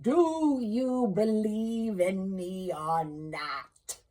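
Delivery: very slow, loud hollow ghost voice; ghostly solemn. Acted. Marley's ghost addressing Scrooge, slow booming ghost. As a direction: toll each word like a bell from the grave.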